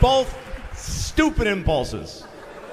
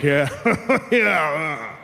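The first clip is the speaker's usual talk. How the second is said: high, slow, knowing warning voice; smug parent; 7 semitones higher, slower than usual. Acted. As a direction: tease like a smug veteran parent. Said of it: "the parents ahead of you are like".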